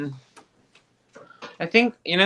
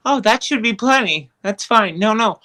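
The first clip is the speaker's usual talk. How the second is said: high, louder mock self-assurance; confident past thinking; 7 semitones higher, 6 dB louder than usual. Voter recalls thinking ten days was plenty, mock-confident. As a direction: breezily brush off any worry, high-pitched.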